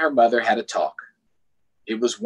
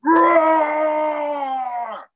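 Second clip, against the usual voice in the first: long loud playful roar, much higher; 6 dB louder than usual. Shown as a demonstration. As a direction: give the kids a huge storytime roar. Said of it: "I need a roar".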